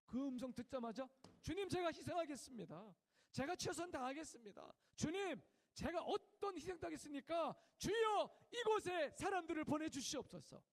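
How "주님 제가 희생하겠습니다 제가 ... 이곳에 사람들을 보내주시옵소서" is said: loud, high, pleading cry; fervent prayer. Retold or imitated. Chaplain vowing to God, loud fervent crying prayer. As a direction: cry out to the Lord in earnest. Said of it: "막 기도한 거예요".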